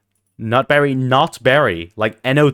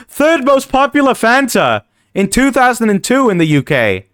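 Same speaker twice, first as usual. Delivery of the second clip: high put-on reading voice; 10 semitones higher, 4 dB louder than usual. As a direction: read it in a silly high voice.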